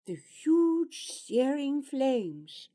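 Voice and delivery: plain reading voice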